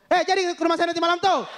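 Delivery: high, sustained calling voice; mock yelling into phone. Bima villager inviting a friend by handphone, mock yelling. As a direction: holler as if the phone weren't there. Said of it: "kalau ngobrol ya emang harus teriak-teriak".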